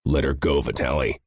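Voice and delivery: ordinary delivery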